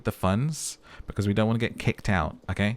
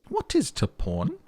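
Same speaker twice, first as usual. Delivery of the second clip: high, slow, baffled question; naive high voice; 8 semitones higher, slower than usual. Acted. Detective asks the old lady, high and baffled. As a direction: ask like a clueless child.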